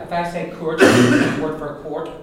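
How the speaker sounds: "courage" without the ua diphthong; wrong "courage" with no diphthong. Shown as a demonstration. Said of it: "If I don't make a diphthong".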